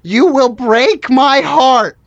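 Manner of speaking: slow, emphatic threat of heartbreak; melodramatic warning. Acted. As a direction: drag out each word with wounded intensity. Fragile worker warns the guests.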